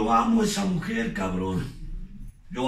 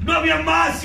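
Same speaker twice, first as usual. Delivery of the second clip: much higher and louder, hurled out; shouted repeat; 9 semitones higher, 6 dB louder than usual. Shown as a demonstration. Speaker repeating it to the group, now shouting. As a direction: throw the words back at full volume. Same words, quiet then shouted.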